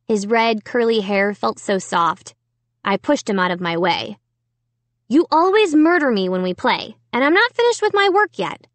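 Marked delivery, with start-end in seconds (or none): 5.1-8.7 put-on annoyed girl voice. A narrator's reading. Sister brushes off brother.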